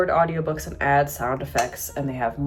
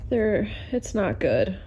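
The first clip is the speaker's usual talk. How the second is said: usual speaking voice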